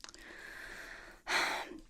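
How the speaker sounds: long, voiceless exhale; slow breathy sigh. Acted. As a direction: let out a drawn-out sigh. Sigh after joking about own skin.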